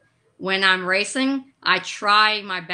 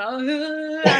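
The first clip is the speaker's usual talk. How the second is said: imitated crying; 7 semitones higher than usual. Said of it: "I was like, like this".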